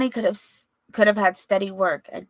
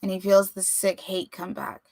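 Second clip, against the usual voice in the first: ordinary delivery, nothing marked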